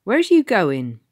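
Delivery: loud call after her; shouted. A narrator's reading. Tom to the girl, shouting. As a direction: shout into the dark. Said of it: "He shouted after her".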